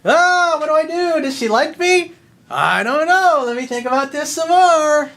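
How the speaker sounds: high, squeaky, panicky whine; mocking a dithering guy. Coach mocking a hesitant follower in a high, loud whine. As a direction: ham up a nervous guy who can't make up his mind. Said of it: "and what does he do?".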